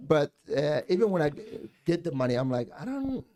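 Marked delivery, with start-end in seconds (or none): none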